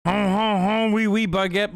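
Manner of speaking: hammed-up French accent mockery. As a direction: caricature the French with a silly accent. Mocks proud French people.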